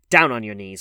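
loud growled command; growled order. A narrator's reading. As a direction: growl it as a threat, hard and loud. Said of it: "growled the man".

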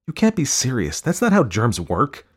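high, incredulous wife; amused disbelief. A narrator's reading. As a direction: scoff at a silly notion, voice lifted. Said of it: "Tara laughed".

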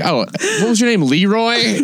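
high mocking voice, playing dumb; mock clueless question. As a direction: ham up the silly name guess. Mocking a stereotype name guess.

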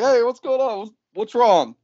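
put-on concerned girls. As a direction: lightly mimic women asking what is up. Voicing the girls coming home.

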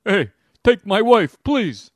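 loud, high comedian one-liner; hammy stand-up comic delivery; old-school comedian. Pretend.

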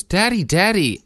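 put-on high, loud call of a small girl; dad imitating his daughter calling him, high and loud; little girl calling. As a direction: call for daddy like an eager toddler.

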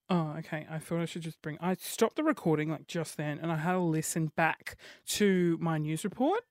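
ordinary talk, nothing marked

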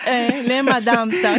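laughing through the words. Real, not acted. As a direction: asks it while giggling. Laughing echo of the question. (بضحكة)

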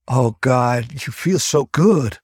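slow, panting out of breath. Acted. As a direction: breathe hard between words. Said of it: "Hudson panted".

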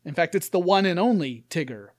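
ordinary delivery

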